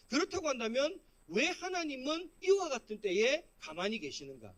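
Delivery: ordinary talk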